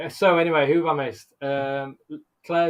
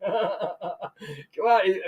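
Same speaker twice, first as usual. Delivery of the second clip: usual speaking voice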